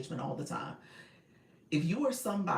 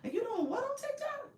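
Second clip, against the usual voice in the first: high, loud mocking voice of scornful older kids; squealing teenage mockery; 4 dB louder than usual. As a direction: mimic teens ridiculing mom's TikTok with laughing disdain. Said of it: "they was like".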